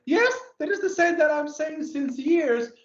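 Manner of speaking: mock self-quote. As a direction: replay her own past words in a lifted, mock voice.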